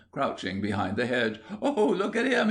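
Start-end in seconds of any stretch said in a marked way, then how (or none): 1.6-2.5 very high, laughing jeer; derisive shout. A narrator's reading. Small boy spots William crouching, derisive. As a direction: squeal with mocking glee. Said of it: "gave a shout of derision".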